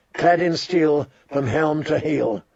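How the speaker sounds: usual speaking voice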